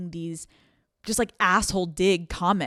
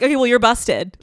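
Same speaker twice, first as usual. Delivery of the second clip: louder, higher mock snark; sassy comeback; 4 semitones higher, 9 dB louder than usual. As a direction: fire back a playful jab. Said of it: "you wanna be like".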